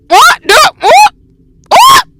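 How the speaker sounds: high shrieking "What?"; panicked shout. Real, not acted. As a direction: scream it in alarm.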